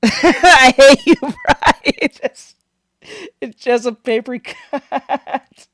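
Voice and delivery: slow, high words broken by laughter; laughing protest. Real, not acted. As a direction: complain while cracking up. Laughing through the shock.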